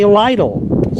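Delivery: "Lidl" said as LIE-dul; "Lidl" rhyming with "idle"; long-i variant. Shown as a demonstration. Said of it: "Some people say".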